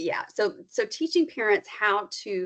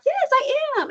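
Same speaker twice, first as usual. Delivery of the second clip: high, louder acted yes; delighted child reply; 5 dB louder than usual. Child answering a parent who noticed the fun, delighted. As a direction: chime back eagerly as the happy child.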